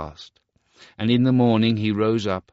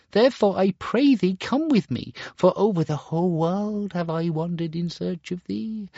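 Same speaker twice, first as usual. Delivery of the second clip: much higher, imploring voice; pleading mother; 12 semitones higher, 3 dB louder than usual. Acted. Mother implores her son to come with her. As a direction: beg your lost son to come.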